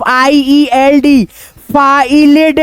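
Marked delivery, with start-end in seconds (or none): 1.7-2.6 फाइलेड read letter by letter as "faa-ee-led"; wrong reading. Shown as a demonstration. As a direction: misread it as three parts, फाइलेड. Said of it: "नहीं पढ़ेंगे". (फाइलेड, तोड़कर गलत उच्चारण)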